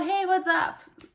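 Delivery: "Hey, what's up" run together as one casual call; lively native-style "Hey, what's up"; linked American greeting. Acted. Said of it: "muito mais rápido do que eu tô falando".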